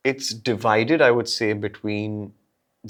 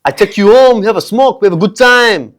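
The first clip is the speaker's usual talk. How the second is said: put-on loud, high French accent; hammed-up French wooing, pitched high; 8 semitones higher, 8 dB louder than usual. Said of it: "scared of the French accent".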